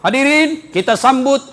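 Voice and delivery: loud, high announcer introducing a guest; mock emcee. Mock emcee welcoming the regent, hammed-up and loud. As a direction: ham up a stage welcome, drawing out the name.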